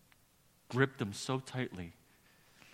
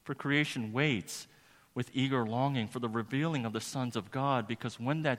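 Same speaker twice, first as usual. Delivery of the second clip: raised, forceful pulpit delivery; impassioned preaching; 4 semitones higher, 7 dB louder than usual. Real, not acted. Preacher proclaiming today's passage to the congregation, impassioned preaching. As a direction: proclaim it, drawing out the weighty words.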